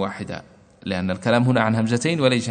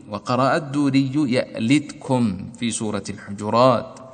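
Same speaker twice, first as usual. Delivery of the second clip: ordinary talk, nothing marked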